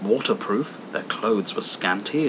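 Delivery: ordinary delivery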